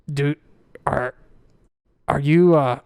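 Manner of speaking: lightly voiced dwarf giving a warning; a hint of a worried dwarf; Tuck the duergar.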